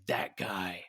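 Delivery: monster voice unlike the speaker's own; Venom. Acted. Quoting the alien watching Spider-Man on TV, creature voice. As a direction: put on a symbiote's creature voice. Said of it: "you hear Venom's voice, he's like".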